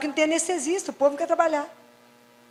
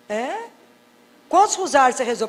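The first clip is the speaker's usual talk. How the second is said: usual speaking voice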